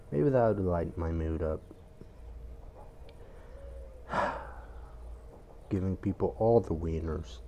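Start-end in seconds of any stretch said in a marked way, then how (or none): 4.1-4.6 soft tired sigh; quiet sigh. Real, not acted. Gloomy before playing.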